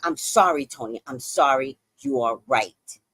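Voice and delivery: low, measured admission of fault; contrite apology. Retold or imitated. Comic apologizing to Tony, low and contrite. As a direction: drop low and concede, word by word.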